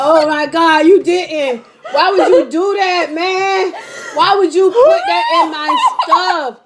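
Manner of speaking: high, loud laughing protest; laughing outrage. Real, not acted. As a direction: shout it, laughing and betrayed. Friend secretly added hot sauce.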